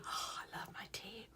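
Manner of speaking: soft breathy whisper, no voice at all. Real, not acted. Telling viewers to brew tea, intimate whisper. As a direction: whisper it like a cosy secret.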